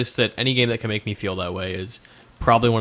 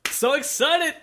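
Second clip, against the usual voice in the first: mock excitement; much slower than usual. Sarcastic excitement about next topic.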